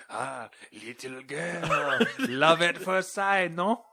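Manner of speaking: slow, suave French skunk croon; Pepe Le Pew. Retold or imitated. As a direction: woo her in a cartoon French accent. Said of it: "thank you for going to Pepe".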